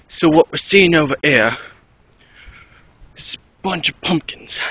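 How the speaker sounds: put-on a shaky try at an Australian accent, drifting English; attempted Australian accent. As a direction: nature documentary host, Australian accent, half-hearted. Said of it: "Maybe I should adopt an Australian accent".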